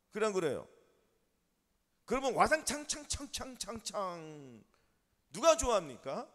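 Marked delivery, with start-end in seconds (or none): 2.3-4.6 slow, drawn-out crash noise with repeated syllables; imitated crashing. Preacher mimicking cups and fans thrown in a fight, imitated crashing. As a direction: voice the smash, letting it ring on. Imitates things smashing in a quarrel.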